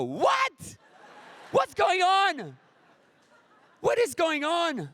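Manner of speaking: high, alarmed cry of confusion; mock panic. Comedian as a driver thrown by a red light, crying out in panic. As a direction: yelp like you can't believe it.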